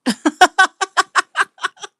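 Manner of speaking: sudden high-pitched burst of laughter; high squealing laugh. Real, not acted. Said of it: "I can't stop laughing at it".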